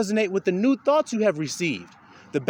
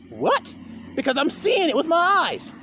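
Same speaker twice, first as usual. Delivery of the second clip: a judging man yelling his defense; indignant shout; 11 semitones higher than usual. Acted. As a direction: shout it like an offended accuser. Voicing a judging person.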